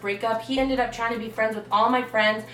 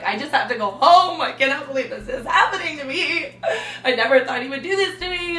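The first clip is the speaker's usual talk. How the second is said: mock teary; 3 semitones higher than usual. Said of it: "I was sobbing so badly".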